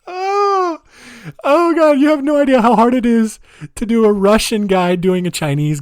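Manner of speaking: high, loud gasp after a laughing fit; real laughing exclamation. Real, not acted. Recovering from own laughing fit.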